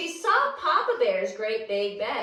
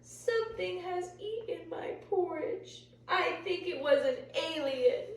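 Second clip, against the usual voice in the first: softer, higher mournful little bear; little bear over his empty bowl, softer and sad; 5 semitones higher, 7 dB quieter than usual. Acted. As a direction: sadly mourn the porridge. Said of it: "looked sadly at his bowl".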